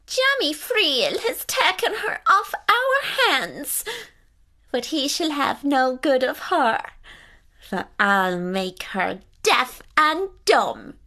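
high spiteful little fairy voice; a tiny fairy woman cursing the girl; tiny fairy woman exclaiming. Acted.

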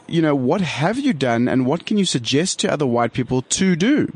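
normal talking voice

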